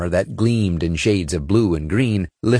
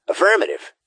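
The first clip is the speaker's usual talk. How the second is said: raised-pitch tech specialist voice; higher character voice; 11 semitones higher than usual. A narrator's reading. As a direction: speak as the squad's tech expert. Gee Whiz replies.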